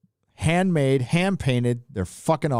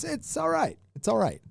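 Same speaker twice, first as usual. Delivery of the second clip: meh verdict; 12 semitones higher, 3 dB quieter than usual. Real, not acted. Lukewarm verdict on Whataburger.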